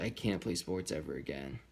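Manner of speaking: low, soft, dejected; understated sad quote, dropped in pitch; retold crying. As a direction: retell it small and defeated, without tears. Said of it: "And I was just crying".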